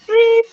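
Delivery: a high, loud imitated electronic beep; mimicked beep. Streamer mimics the annoying noise she keeps hearing, high loud beep. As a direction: squeak out the bleep sound like a machine. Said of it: "What is that friggin' noise I keep hearing?".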